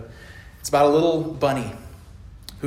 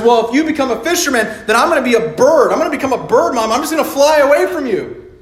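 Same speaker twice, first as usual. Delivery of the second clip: put-on higher, louder childish taunt; a stubborn kid bunny daring mom; lightly voiced bunny, high and cheeky; defiant little bunny; 10 semitones higher, 9 dB louder, faster than usual. Little bunny escalates threat.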